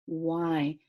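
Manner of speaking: usual speaking voice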